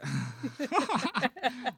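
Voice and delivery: high burst of real laughter; loud laugh. Real, not acted. Friend cracking up at the sibling joke, loud laugh.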